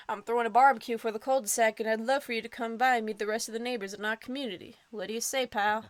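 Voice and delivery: fast, eager invitation; 1 rushed eager pitch. Acted. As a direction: rattle it off cheerfully. Eager neighbor rushing invite.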